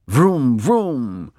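two revving vrooms, each falling away; car-engine vrooms. A narrator's reading. Mister Watson still dreaming of his car, engine noises. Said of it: "Mister Watson said".

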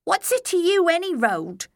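high demanding goblin voice; a goblin pushing back hard. A narrator's reading. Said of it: "he demanded".

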